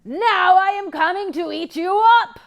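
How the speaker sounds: troll menacing the big goat; troll threat. Acted. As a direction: threaten to gobble him. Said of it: "cried the troll".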